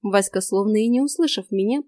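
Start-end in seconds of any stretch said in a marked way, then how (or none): none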